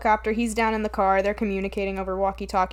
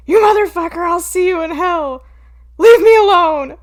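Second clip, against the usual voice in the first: louder and higher, yelling at him; mock furious yell; 8 semitones higher, 11 dB louder than usual. Imitating the captain screaming at a man in his office. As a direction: blow up like a raging police captain. Angry captain kicking someone out.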